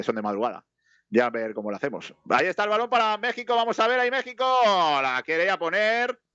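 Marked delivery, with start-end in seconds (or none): 2.3-6.2 high-pitched match call slipping into Mexican accent. Real, not acted. Commentator calls Mexico's attack, high Mexican-accented play-by-play. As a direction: let a Mexican accent creep into the live call. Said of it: "me sale el acento mexicano ya".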